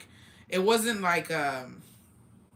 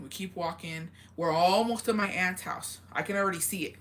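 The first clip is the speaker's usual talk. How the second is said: ordinary delivery, nothing marked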